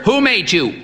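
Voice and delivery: high, booming drill question; martial tone. A narrator's reading. Old priest quizzing the boy on the catechism, martial. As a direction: bark it like a drill sergeant. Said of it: "in a martial tone".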